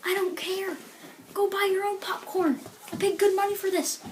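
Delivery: high, curt brush-off; dismissive refusal. Retold or imitated. Teller refusing the popcorn beggar, high curt brush-off. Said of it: "I'm like".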